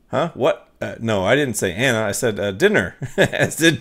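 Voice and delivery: usual speaking voice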